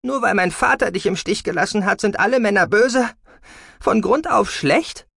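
high, loud, indignant questions; heated daughter in a quarrel; angry accusation. A narrator's reading. Daughter quarrels with mother about father.

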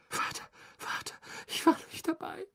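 soft, breathy whimper rising high; desperate plea. A narrator's reading. Boy taken by Dementors begs his father, breathy desperate plea.

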